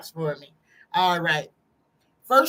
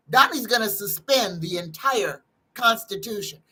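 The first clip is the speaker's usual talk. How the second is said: usual speaking voice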